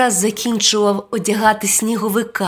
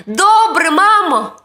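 put-on high, bright-pitched child voice; loud, high boyish reply; eager boy; 10 semitones higher, 5 dB louder than usual. A narrator's reading. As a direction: answer mom as an obedient little boy. Boy answers his mother.

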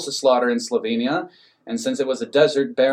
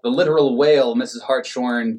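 ordinary delivery, nothing marked

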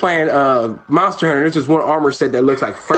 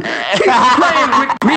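ordinary talk

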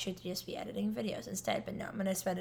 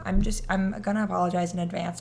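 ordinary talk

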